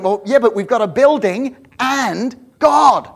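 rousing preacher crescendo; full-force preaching. Acted. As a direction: build the congregation to a peak. Sermon climax, repeated "and God.

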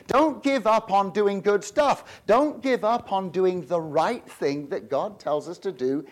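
slow, deliberate exhortation; slow emphatic. Real, not acted. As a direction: lean on each word to urge them on. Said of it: "explaining the verse earnestly".

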